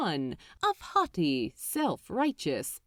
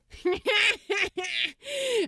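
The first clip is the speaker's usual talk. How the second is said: gleeful high cackling laugh; high giddy cackle; 11 semitones higher than usual. A narrator's reading. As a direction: let out a delighted cackle. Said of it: "Crowley cackled".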